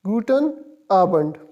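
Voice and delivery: slow, careful Guten Abend, GOO-ten AH-bent; German pronunciation demo. Shown as a demonstration. Teacher shows students how to say it, slow and careful. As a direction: spell out guten Abend slowly, syllable by syllable. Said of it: "इसको pronounce किस तरह कर देंगे".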